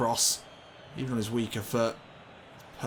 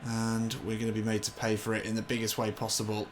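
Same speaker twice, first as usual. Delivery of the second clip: ordinary delivery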